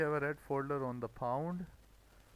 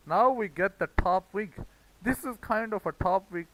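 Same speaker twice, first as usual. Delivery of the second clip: much louder, higher burst of excitement; excited; 5 semitones higher, 8 dB louder than usual. Real, not acted. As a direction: point at the chart like the trade just landed. Excited as the setup appears.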